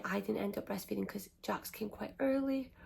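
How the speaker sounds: ordinary talk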